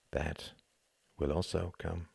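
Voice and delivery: soft, hushed, grim; quiet inner thought. A narrator's reading. Tom thinking grimly of coming bombs, hushed. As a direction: say it to yourself. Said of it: "and thought".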